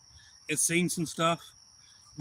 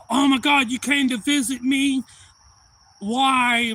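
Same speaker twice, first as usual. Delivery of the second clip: high, loud imitation of an overjoyed kid; acted squeal of disbelief; thrilled child; 10 semitones higher, 9 dB louder, slower than usual. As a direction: gush it high in mock amazement. Retelling thrilled child meeting Santa.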